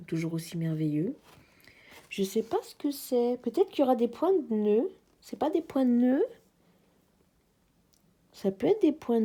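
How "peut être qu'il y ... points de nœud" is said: ordinary delivery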